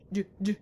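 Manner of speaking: "d, d" as short crisp d sounds; teacher sounding out the letter d. Shown as a demonstration. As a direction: tap out the d sound twice. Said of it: "gerbil starts with d".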